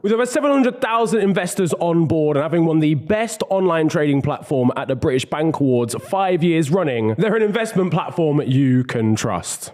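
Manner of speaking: ad read. Acted. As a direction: read it like a sponsor spot. Reads sponsor ad.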